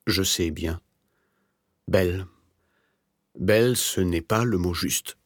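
mildly agitated, slow self-correction. A narrator's reading. Sick writer doubts his own word, mildly agitated. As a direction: fret over the word, lightly acted. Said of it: "dit l'autre en s'agitant".

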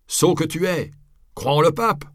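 high, loud jeer; angry heckler. A narrator's reading. Heckler jeers at the friar to believe the pope, loud. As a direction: scorn him as a fool.

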